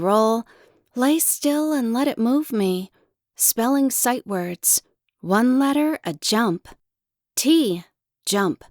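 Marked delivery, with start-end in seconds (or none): none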